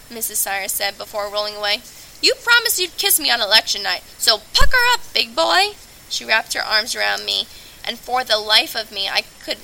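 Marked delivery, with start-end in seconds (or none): 2.2-5.8 put-on high, loud, teasing old woman; playful demand with a big squeal on pucker up. June Sires claims her election-night kiss, loud and teasing.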